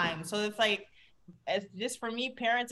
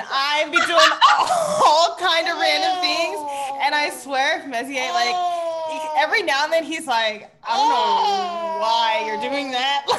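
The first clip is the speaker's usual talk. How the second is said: laughing; 5 semitones higher, 7 dB louder than usual. Real, not acted. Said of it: "laughing while telling story".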